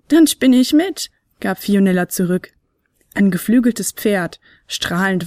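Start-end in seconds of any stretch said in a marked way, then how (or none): 0.1-1.1 higher, louder small flute voice, stunned; seeing a flying horse; amazed little flute. Acted. Fionella amazed.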